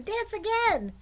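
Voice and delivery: high, loud shout; excited children. Acted. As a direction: demand it like delighted kids. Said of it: "cried the children".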